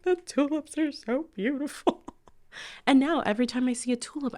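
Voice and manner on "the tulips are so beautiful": mock weepy squeak; retold tearfulness. Alone in the car by a tulip field, mock tearful. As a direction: wail it high, imitating yourself near tears. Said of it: "damn near in tears".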